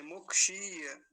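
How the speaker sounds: मुक्षीय slow and loud, muk-sheee-ya; long drawn ī. Shown as a demonstration. Teacher demonstrating the long vowel, drawn-out ī. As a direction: pull the shee of मुक्षीय out long. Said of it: "और लंबा खींच के बोलेंगे".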